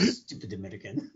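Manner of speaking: put-on low, soft, sneering French accent; bad French accent. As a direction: mutter it as a scornful Frenchman. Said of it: "That was my bad French accent".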